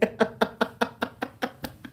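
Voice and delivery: a breathless high giggle; airy high giggle. Real, not acted. Laughing at his own YouTuber mockery, high and airy.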